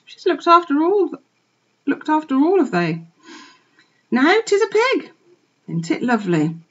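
high, loud chattering country wife; farmer's wife. A narrator's reading. Missus Hoggett gushing to her husband about the pig, high and chatty. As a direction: gush like a talkative farmer's wife.

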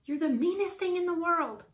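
high, indignant outburst; storybook shout. A narrator's reading. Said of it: "Annie shouted".

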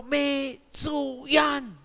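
very drawn-out cry of approval; long, slow mock cheer. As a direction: stretch the word right out. Absurd cheering answer to falling man.

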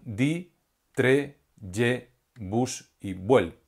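"di", "tre", "ye", "bus", "vuel" spaced out, the last ones slowly; stressed syllables alone. Shown as a demonstration. Teacher naming the stressed syllables for the class, slow. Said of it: "sílabas tónicas o de mayor intensidad".

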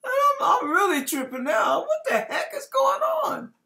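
shouted, high, panicked disbelief; alarmed outburst. Retold or imitated. Preacher reliving his alarm at the news, shouting high. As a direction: blurt it out high and loud in alarm.

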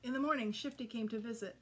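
ordinary delivery, nothing marked

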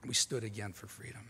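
a hushed, reflective echo of "We stood again for freedom"; soft echo. Acted.

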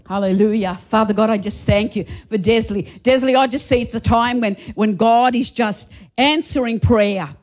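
ordinary talk

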